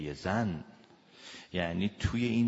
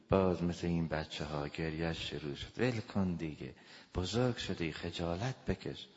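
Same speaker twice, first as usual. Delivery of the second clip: low, dismissive belittling; تحقیرآمیز; 4 semitones lower, 6 dB quieter than usual. Acted. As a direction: scold them like a child, quietly and with contempt. (با تحقیر و آهسته)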